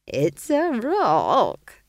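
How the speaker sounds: high, drawn-out amused crow; chuckling. A narrator's reading. Old witch teases about the new pet, chuckling. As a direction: stretch it right out with amusement, high and loud. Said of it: "chuckled Nanny Ogg".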